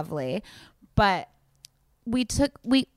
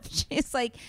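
laughing; 8 semitones higher, 3 dB quieter than usual. Real, not acted. Laughing while retelling.

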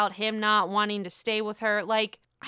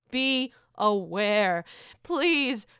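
slow warning; much slower than usual. Real, not acted. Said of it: "slow warning".

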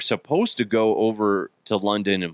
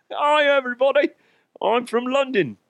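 high-pitched mock London accent; attempted London accent; 12 semitones higher than usual. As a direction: greet in a badly faked London accent. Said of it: "nail that damn accent".

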